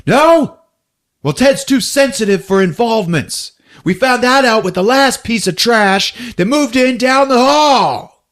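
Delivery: high, hammed-up angry outburst; acted indignation. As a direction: throw it out high, like a heated neighbor. Retold quarrel, character taunting.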